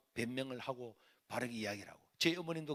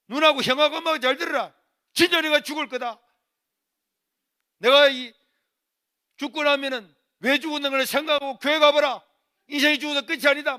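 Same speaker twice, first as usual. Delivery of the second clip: desperate ultimatum; 12 semitones higher, 20 dB louder than usual. Retold or imitated. Son repeats hunger-strike ultimatum.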